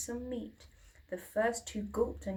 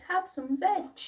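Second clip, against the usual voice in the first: high, louder happy greeting; friendly hoot; 8 semitones higher, 5 dB louder than usual. A narrator's reading. Duckbills hooting hello to the new friend. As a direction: hoot a warm welcome. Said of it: "they hooted".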